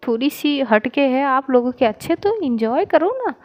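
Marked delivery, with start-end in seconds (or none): none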